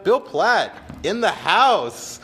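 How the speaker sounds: loud, high, slow hype-man call; hype announcement. Real, not acted. Hyping up a viewer who joined the stream, slow hype call. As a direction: announce him like he just walked into the arena.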